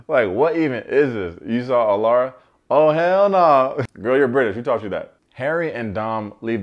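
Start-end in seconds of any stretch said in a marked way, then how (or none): 0.1-5.1 louder, higher, stretched hell no; incredulous outburst. Real, not acted. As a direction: shout it in disbelief. Reacting to British contestant's slang.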